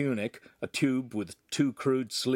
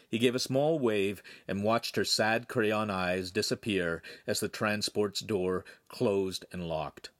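ordinary delivery, nothing marked

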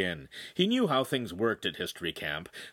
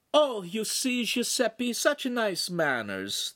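high, gushing signora; effusive Italian hostess praise; 12 semitones higher, slower than usual. A narrator's reading. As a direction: coo with delight, pitched way up. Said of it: "the Signora gushed".

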